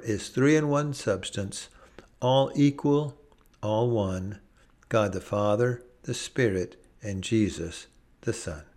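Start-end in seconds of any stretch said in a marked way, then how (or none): none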